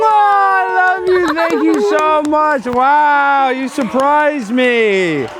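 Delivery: high, drawn-out cries of delight; thrilled, slow and high-pitched gush; gushing thanks. Real, not acted. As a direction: thank them loudly in long, high swoops. Gushing thanks after surprise video.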